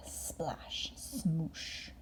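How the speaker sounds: plain reading voice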